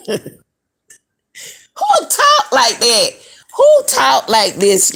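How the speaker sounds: high, loud, slow incredulous question; indignant disbelief. Real, not acted. Vlogger baffled by the friend's phrasing, high loud slow disbelief. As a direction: drag out the question in outrage.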